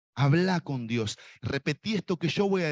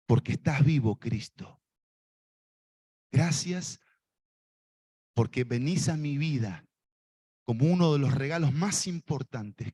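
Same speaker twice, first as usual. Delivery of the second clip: led prayer; 2 semitones higher than usual. Shown as a demonstration. Preacher leading the congregation in prayer, fervent.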